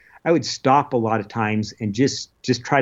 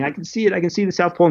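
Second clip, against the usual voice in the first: higher, half-sobbing repeat; retold bawling; 7 semitones higher, much faster than usual. Explorer repeating to his wife through tears, mild imitated sobbing. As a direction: cry it out again, pitched up. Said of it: "And I'm just bawling".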